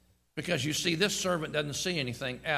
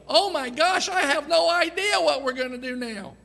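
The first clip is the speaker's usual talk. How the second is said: high, loud fretful wail; mock panic; 12 semitones higher, 8 dB louder than usual. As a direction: overplay the panic as a hammed-up caricature. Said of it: "He didn't go".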